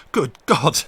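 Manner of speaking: sudden loud cry of shock; cried out. Acted. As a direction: burst out in horror. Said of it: "he cried".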